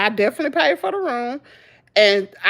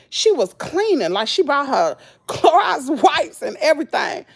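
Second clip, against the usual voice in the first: ordinary talk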